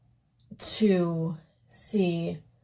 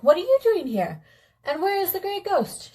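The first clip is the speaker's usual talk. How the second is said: raised, urgent prince's voice; prince's startled question; 11 semitones higher than usual. Acted. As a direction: redo the line as the shocked prince, lifted up high. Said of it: "no, he was like".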